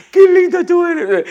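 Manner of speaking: very high, loud, drawn-out gushing; mock cooing. Eve talking to the animals, exaggerated high cooing. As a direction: coo it slowly in an exaggerated high voice.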